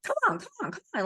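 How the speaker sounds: imitated nagging, pitch leaping high at first; putting pressure. Coach imitating someone pushing their inner little girl, high insistent urging. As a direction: hurry someone along in a mock pushy voice. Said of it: "like, putting pressure".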